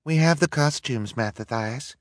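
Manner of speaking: high-pitched woman calling; lightly voiced distant call; calling from afar. A narrator's reading. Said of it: "voice ... was faint in the smoke".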